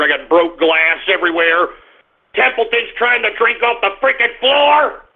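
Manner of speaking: ordinary talk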